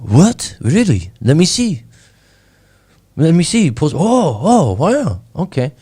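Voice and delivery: high, swooping imitation of an impressed man; hammed-up wowed reaction, pitch leaping up; mock amazement. Pompous man learns of the YouTube channel, mock amazement.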